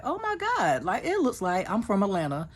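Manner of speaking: sudden high squeal of amazement; amazed. Retold or imitated. She recalls seeing the giant mall, high and amazed. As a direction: gasp out your wonder at the huge mall. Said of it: "I was like".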